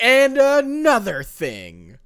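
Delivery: slow, high, loud dragged-out comeback; mock argumentative. Friend acting out someone who keeps arguing, dragged out and loud. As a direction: ham up refusing to let the argument go.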